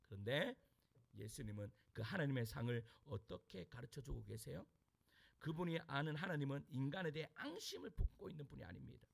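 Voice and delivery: usual speaking voice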